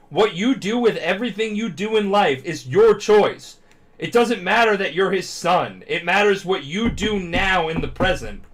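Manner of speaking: loud, high, slow heartfelt hero speech; impassioned speech. Retold or imitated. Hero yelling at his rival in the fight, impassioned shout.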